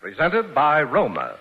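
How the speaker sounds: usual speaking voice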